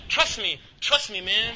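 emphatic persuading; insistent urging. Retold or imitated. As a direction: lean hard on it to win him over. Brother insists on his plan.

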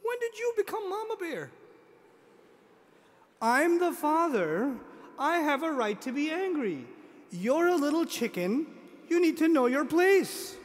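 hammed-up outrage at a cheeky daughter; mock indignant father. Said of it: "I look at my girl and go".

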